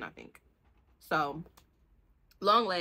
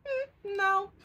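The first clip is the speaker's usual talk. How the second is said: hammed-up high teasing no; a playful imitated denial, much higher; mock flat refusal; 13 semitones higher than usual. Ronan refusing to let Mila go, mock teasing no. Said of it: "And he like".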